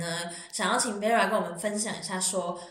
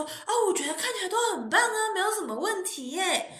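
put-on young doctor; 10 semitones higher than usual. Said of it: "比较那种年轻的医生".

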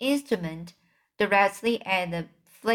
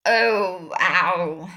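a cry of pain, a touch hammed-up; pained giant cry; 4 dB louder than usual. A narrator's reading. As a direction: groan it out like a hurt giant.

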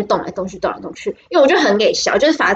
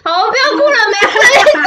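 high, soothing mock comfort; comforting a crier; 11 semitones higher, 4 dB louder than usual. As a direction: coax the crying girls to stop, lightly teary. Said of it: "就说什么".

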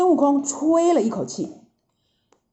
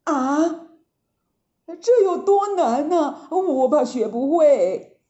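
lightly voiced Monkey, a bit higher and startled; stunned worry; 4 semitones higher than usual. A narrator's reading. 悟空听呆了，惊讶又担心地问. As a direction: gasp it out, worried he can't learn. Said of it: "呆住了".

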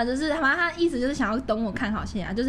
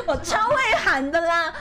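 laughing; 7 semitones higher, 5 dB louder than usual. Real, not acted. Laughing while boasting.

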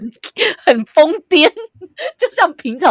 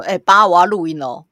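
louder call to the next room; 8 dB louder than usual. Retold or imitated. Daughter calls out to dad in next room, louder. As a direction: call out to dad watching Netflix. Calling to dad through wall.

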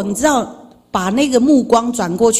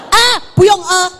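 retold shout; 7 semitones higher, 5 dB louder than usual. Shouting in the elevator story.

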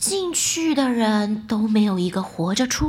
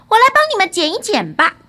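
loud, higher and quick; loud declaration; 6 semitones higher, 8 dB louder, faster than usual. A narrator's reading. Son offering to trim the cats' claws, loud and fast. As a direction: announce it briskly at full voice. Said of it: "儿子大声地说道".

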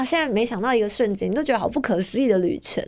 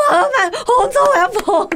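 tearful laughing quote; 12 semitones higher, 6 dB louder than usual. Retold or imitated. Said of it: "然后他就说".

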